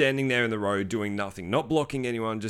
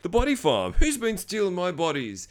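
higher, mock outraged voice asking about bodies; mock zombie-film character; 8 semitones higher than usual. Vlogger mocks a film character: hammed-up outrage. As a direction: play the body farm owner, hammed up. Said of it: "People are like".

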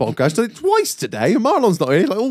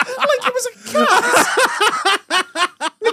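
words squeezed out through high laughter; can barely get it out for laughing; 14 semitones higher than usual. Real, not acted. Telling the podcast about the guide, laughing hard.

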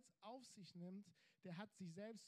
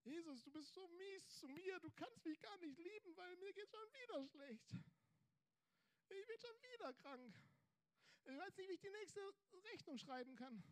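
much higher, pitiful whining voice; whiny believer; 9 semitones higher than usual.

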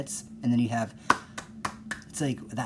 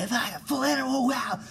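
much higher, squealed fake excitement; mock over-enthusiasm; 12 semitones higher than usual. Podcaster mocking high-energy people with a squealed gush. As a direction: gush like a giddy superfan. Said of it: "those noises were I just made".

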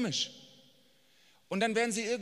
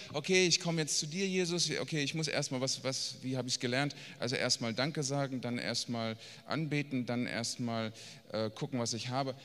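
roundabout praying; 6 semitones lower than usual. Acted. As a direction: feel your way through a learned prayer routine. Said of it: "gar nicht drumherum reden und so". (zögerlich, umständlich betend)

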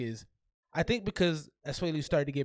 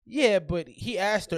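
ordinary delivery

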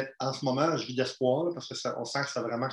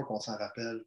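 usual speaking voice

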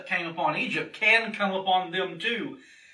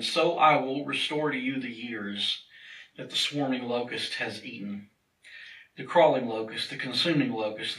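lower, measured Bible reading voice; scripture reading; 4 semitones lower, 3 dB quieter than usual. Acted. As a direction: read the passage solemnly from the pulpit. Reads the Bible passage aloud.

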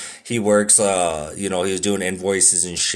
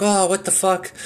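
higher, put-on shocked yelp; mock shock; 10 semitones higher than usual. Kid sipping salty coffee, hammed-up shock. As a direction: act shocked at the taste. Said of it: "took a small sip and was like".

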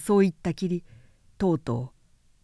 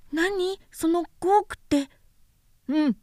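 put-on light, high boyish voice, curious; a small boy asking innocently; little prince voice; 9 semitones higher, slower than usual. A narrator's reading. Little prince asking the vain man, high childlike voice.

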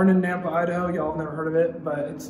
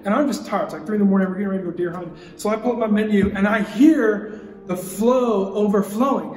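usual speaking voice

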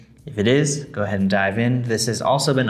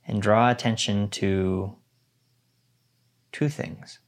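guided meditation. Acted. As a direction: guide them gently into stillness.

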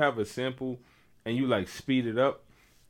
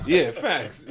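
laughing; 7 semitones higher, 6 dB louder than usual. Real, not acted. Laughing at the joke.

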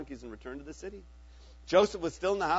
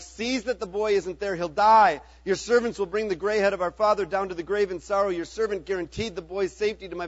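pleading; 3 semitones higher, 8 dB louder than usual. Acted. Judah pleading for Benjamin.

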